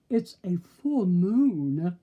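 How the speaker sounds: slow, loud, exasperated wolf. A narrator's reading. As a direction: spell it out, fed up. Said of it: "one exasperated wolf exclaimed".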